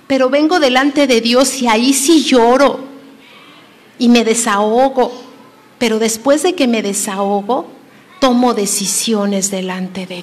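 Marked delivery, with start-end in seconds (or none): none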